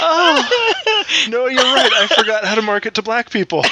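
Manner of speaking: words through real laughter; laughing. Real, not acted. Cracking up after the joke.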